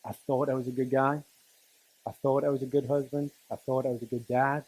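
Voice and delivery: ordinary talk, nothing marked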